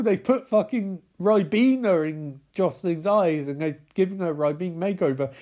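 ordinary talk, nothing marked

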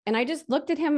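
usual speaking voice

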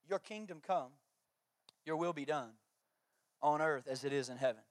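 soft, quiet reverent reading; reverent recitation. Acted. Pastor reciting the Lord's Prayer to the congregation, quietly reverent.

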